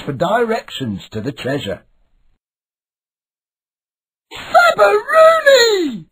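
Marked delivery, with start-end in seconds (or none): 4.3-6.1 high, slow, stretched joyful cry; excited pirate shout. A narrator's reading. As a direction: holler it like a thrilled young pirate. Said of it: "shouted Milo".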